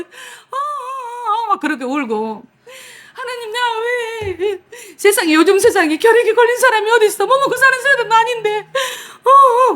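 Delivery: high wailing sob, retold; crying to God in the hospital, a high sobbing wail; reenacted crying. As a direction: act out the hospital weeping, voice high and breaking. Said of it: "리얼하게 해줄 수 있는 것이".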